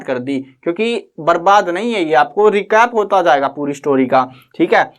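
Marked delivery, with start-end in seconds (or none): none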